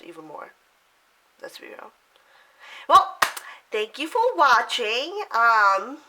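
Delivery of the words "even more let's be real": breathy aside, barely voiced; whispered aside. Real, not acted. Tells viewers, half under her breath, whispered. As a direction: drop to a whisper for the joke.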